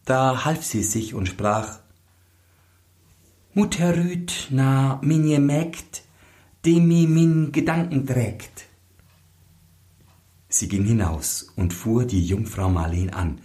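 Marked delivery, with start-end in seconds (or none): 3.5-8.6 high, otherworldly chanted spell; sing-song magic verse in a raised voice; bride's charm rhyme. Acted. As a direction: intone the charm like a secret incantation.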